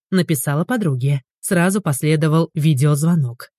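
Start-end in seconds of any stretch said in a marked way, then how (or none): none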